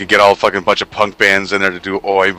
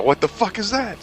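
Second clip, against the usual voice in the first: mock outrage; 12 semitones higher, 4 dB quieter than usual. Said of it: "you knew I was gonna go".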